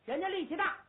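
plain reading voice

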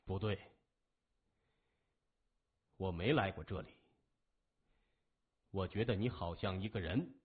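low, heavy gang leader; gruff boss voice. A narrator's reading. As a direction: drop low and weighty. Gang boss voice, much lower.

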